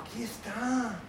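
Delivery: put-on slight Mexican accent on aquí está. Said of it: "me ha salido acento mexicano". (acento mexicano ligero)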